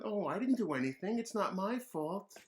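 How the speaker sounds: put-on mild childish whine; innocent kid voice. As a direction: play the angel with a halo. David pleads innocence.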